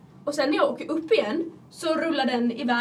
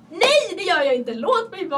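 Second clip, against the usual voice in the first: exasperated refusal; 5 semitones higher, 6 dB louder than usual. Retold or imitated. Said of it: "Man bara".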